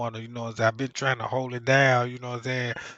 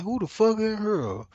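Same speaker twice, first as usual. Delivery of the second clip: startled; 7 semitones higher than usual. Retold or imitated. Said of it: "I'm like".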